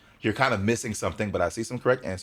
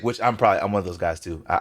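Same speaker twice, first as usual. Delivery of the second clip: normal talking voice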